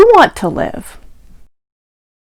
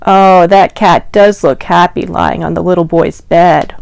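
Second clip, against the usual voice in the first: ordinary delivery, nothing marked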